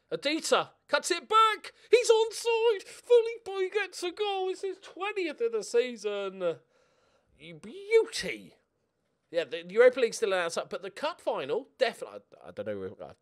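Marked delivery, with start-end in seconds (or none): 0.1-8.6 much higher, loud live commentary voice; thrilled play-by-play as his striker scores; excited goal call. Real, not acted.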